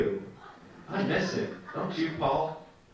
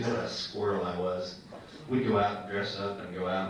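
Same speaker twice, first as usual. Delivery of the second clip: normal talking voice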